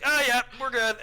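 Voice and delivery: loud, high, carefree all-clear; breezy cop. Retold or imitated. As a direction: toss it off like nothing happened. Sergeant after kicking the bomb.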